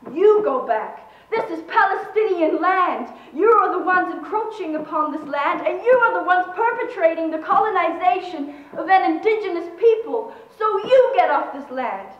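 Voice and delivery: loud, indignant counter-order; righteous, forceful accusation; defiant retort. A narrator's reading. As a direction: throw the order back forcefully. Protester retorts to the commander.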